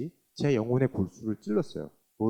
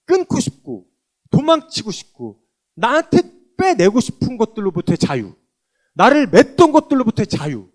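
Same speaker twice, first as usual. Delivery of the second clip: loud, higher, fervent testimony; preaching; 7 semitones higher, 10 dB louder than usual. Acted. Testifying to the congregation, fervent loud preaching. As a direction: proclaim it with full force.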